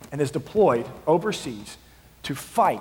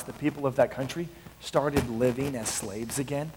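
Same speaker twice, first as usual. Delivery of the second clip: ordinary talk, nothing marked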